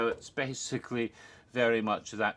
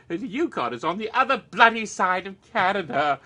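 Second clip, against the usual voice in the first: excited indignation; 9 semitones higher, 8 dB louder than usual. Real, not acted. Indignant about Quebec vs Yukon.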